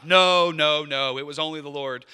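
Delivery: loud protest on the first no, then settling; mock humble protest. Worship leader deflecting praise to the Lord, hammed-up protest. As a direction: brush off the compliment a bit too hard. Acted false humility reply.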